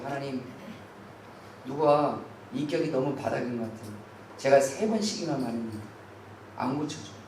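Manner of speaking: soft, low muttered complaint to God; quiet prayer. Shown as a demonstration. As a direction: pray it under your breath. Quiet prayer voiced in sermon. (조용히 하나님께 털어놓는 기도)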